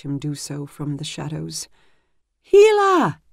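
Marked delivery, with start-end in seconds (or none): 2.4-3.2 much higher, much louder desperate call; frantic shout. A narrator's reading. A squire frantically shouting for the wise woman. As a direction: yell her name through the door in panic. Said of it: "that frantic voice shouting through her door".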